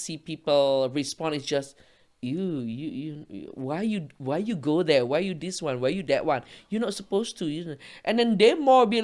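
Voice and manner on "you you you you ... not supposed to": imitating mild, hesitant objectors in a softer voice; mock a polite, soft-spoken complaint; softly, with halting repeats; talking nicely. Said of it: "Because you're talking nicely".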